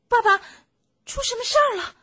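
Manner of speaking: put-on high, loud child-fox yell; little foxes crying out. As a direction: shout it high like an alarmed fox cub calling Dad. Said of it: "小狐狸们大叫道". (小狐狸高声大叫)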